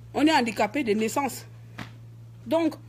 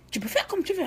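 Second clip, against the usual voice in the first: retold retort; 8 semitones higher than usual. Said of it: "je dis".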